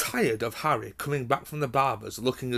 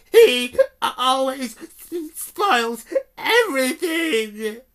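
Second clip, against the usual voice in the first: high, louder, slow whiny fake crying; a spoilt boy wailing to get his way; hammed-up pretend sobs; fake sobbing yell; 14 semitones higher, 11 dB louder, much slower than usual. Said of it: "yelled between huge pretend sobs".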